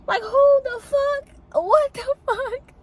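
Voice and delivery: high, slow, stretched-out disbelief; outraged disbelief. Real, not acted. Telling viewers about the stolen converter, high and slow in outrage. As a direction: pull each word out high and slow in outrage.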